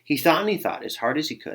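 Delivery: plain reading voice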